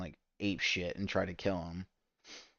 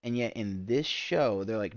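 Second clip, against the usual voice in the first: ordinary talk, nothing marked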